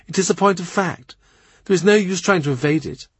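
a candid courtier telling hard truths; lightly voiced, tart lady; blunt lady-in-waiting. Rochford lecturing the queen.

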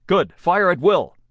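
loud, high command to fire; shouting orders over battle. A narrator's reading. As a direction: give the order over the guns.

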